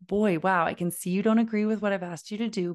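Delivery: fast, rushing into the fix; hurried redirection. Shown as a demonstration. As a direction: hurry past the feeling. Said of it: "jumping right into the solution".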